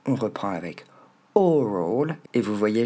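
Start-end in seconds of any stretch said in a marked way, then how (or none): 1.3-2.2 ORAL with a strong, long OR; English "oral" as "OR-ol"; first-syllable stress. Shown as a demonstration. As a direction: punch the OR of "oral", ease off into "ol". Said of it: "je marque bien l'accent tonique sur la première syllabe".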